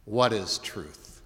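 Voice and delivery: slow mock scoff of a sceptical governor; scoffing Pilate. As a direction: sneer it slowly. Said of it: "Pilate scoffs and says".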